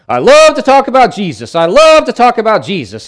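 high, loud, beaming repeat of the line; eager quote. Retold or imitated. Missionary in the hospital telling the pastor, high and loud with joy. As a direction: gush it out like a man overjoyed. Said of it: "And he kept saying".

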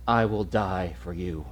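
low, soft and slow; Jesus, softly. Retold or imitated. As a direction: tell him gently, almost breathless. Jesus speaks to Barabbas.

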